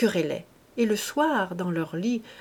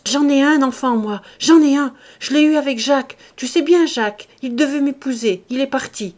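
loud, high, pleading wife near tears; distraught confession; 5 semitones higher, 9 dB louder than usual. A narrator's reading. Wife blurts out to her husband, high and loud, near tears. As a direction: blurt out the secret through tears.

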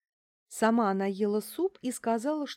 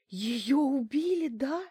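high, drawn-out stunned question; stunned and shaken, asking in a higher voice; shocked; 7 semitones higher, slower than usual. A narrator's reading. Shaken Larisa asking if her friend was killed, shocked. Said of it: "прошептала потрясённая Лариса".

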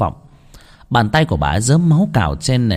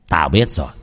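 stern master; 6 semitones lower than usual. Acted. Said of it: "Lang Trượng nghiêm giọng".

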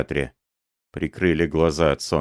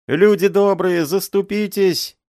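high, loud desperate pleading; old man wailing; 13 semitones higher, 4 dB louder than usual. A narrator's reading. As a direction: beg and wail, voice up high. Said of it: "этот воющий голос".

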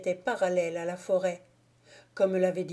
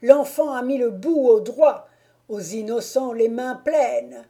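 loud, raised jubilant shout; excited cry; 4 semitones higher, 8 dB louder than usual. A narrator's reading. Moquet cheering the youngster's hit, crying out. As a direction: crow over the lucky shot. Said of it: "cria Moquet".

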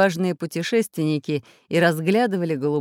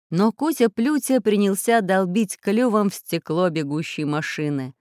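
plain reading voice

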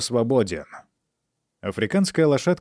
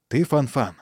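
usual speaking voice

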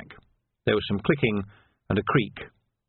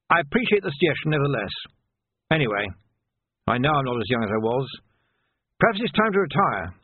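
put-on higher-pitched, dignified old officer; an old captain conceding his age; resigned old captain; 4 semitones higher than usual. Old captain considering retirement before his sergeant, resigned.

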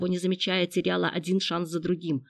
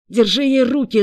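loud, higher-pitched frantic order; wild shouted command; frenzied yell; 5 semitones higher, 10 dB louder than usual. A narrator's reading. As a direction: scream it at an accomplice. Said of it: "иступлённо заорал Андрей".

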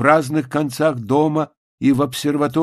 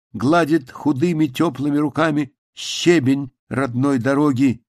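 plain reading voice

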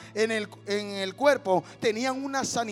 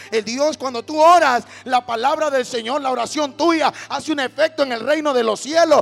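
loud, fervent preacher's cry; full-force preaching; 9 dB louder than usual. Acted. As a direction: proclaim it loud to the congregation. (predicando con fuerza)

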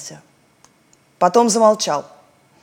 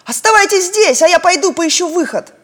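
higher, louder resolute voice; Malyak, higher character voice; 9 semitones higher, 6 dB louder than usual. Acted. Malyak tells the others to stay while he searches, resolute. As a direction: take charge of the group.

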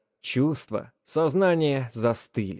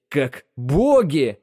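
восторженно; 3 semitones higher, 4 dB louder, much slower than usual. Acted. Бэрон увлечён собственным красноречием.

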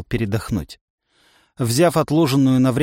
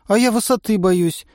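put-on higher, sad girl's confession; 8 semitones higher than usual. A narrator's reading. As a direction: admit it wistfully. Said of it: "грустно сообщила девушка". (грустно, девичьим голосом)

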